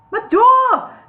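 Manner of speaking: high, loud squeal of dismay. A narrator's reading. Bingo seeing his collapsed door, high loud squeal. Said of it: "squealed Bingo".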